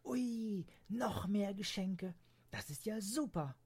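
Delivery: breathless high whisper of delight; high excited child voice; excited girl. Acted. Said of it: "Leonie war aufgeregt".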